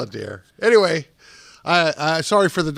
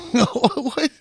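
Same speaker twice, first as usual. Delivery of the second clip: amused bafflement; 9 semitones higher than usual. Real, not acted. Baffled by the poor water tag.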